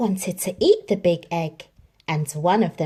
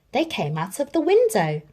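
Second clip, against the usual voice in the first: ordinary delivery, nothing marked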